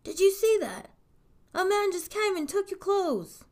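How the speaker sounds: high-pitched, excited yell; alarmed yelling. A narrator's reading. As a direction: call it out urgently across the water. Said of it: "Puss in Boots would yell".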